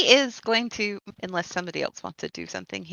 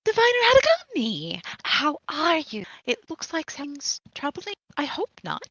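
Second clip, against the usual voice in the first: Lady Blossom voice; 7 semitones higher than usual. Acted. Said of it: "wanders over to her and says".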